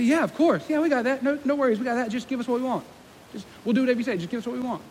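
high, offhand, brushing-it-off chatter; glib eager agreement. Acted. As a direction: agree too readily, just to get the wish. Disciples glibly brush off the warning.

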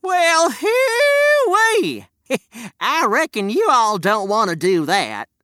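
put-on very high, slow drawling old-timer; old prospector. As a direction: holler it like a crusty old miner. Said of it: "old prospector ... said to the man".

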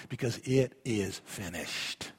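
low, soft, slow solemn statement; solemn hush. Acted. Pastor declaring Christ's work done, hushed and slow. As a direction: let it sink in, low and quiet.

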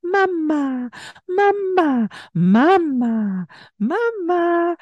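high repeated mama; baby talk. Shown as a demonstration. Mother in her baby's face, high repeated mama. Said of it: "all in her face, going".

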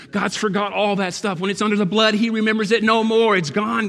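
loud, high preaching climax; full-force preaching. Acted. Pastor preaching hard to the church. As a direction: preach it out, building to a shout.

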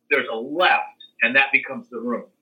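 ordinary talk

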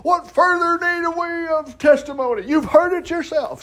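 high-pitched mock indignation; high, theatrical outcry of outrage; outraged high priest. High priest's outburst, tearing his clothes.

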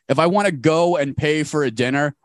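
ordinary talk, nothing marked

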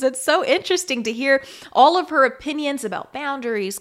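ordinary delivery

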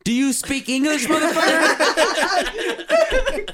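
[name]. Acted. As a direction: get in the recruit's face, rising to a laughing yell. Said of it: "[name]".

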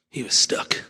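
hushed repeat; whispered. Acted. Pastor echoing Jonah's state, whispered. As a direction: drop to a whisper on stuck.

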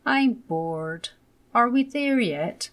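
put-on high, bored child voice; a little boy groaning that he's bored.

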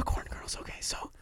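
whispered through giggles; whisper, holding back laughter. Real, not acted. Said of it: "we have to whisper".

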